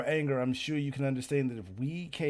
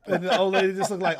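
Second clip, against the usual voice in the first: laughing narration; 5 semitones higher, 9 dB louder, faster than usual. Real, not acted.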